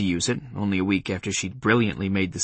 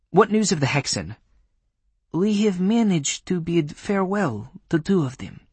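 put-on Polish accent; 8 semitones higher than usual. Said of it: "in a Polish accent".

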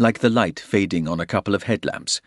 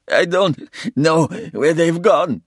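high, loud, sobbing plea; 11 semitones higher, 6 dB louder than usual. A narrator's reading. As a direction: cry out the words in despair. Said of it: "he sobbed".

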